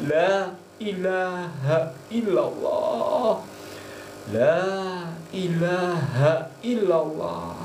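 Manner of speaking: lifted, chanting prayer voice, stretched on the praises; devotional prayer. Acted. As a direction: intone each plea to God, slowing at the end. Leads a spoken prayer.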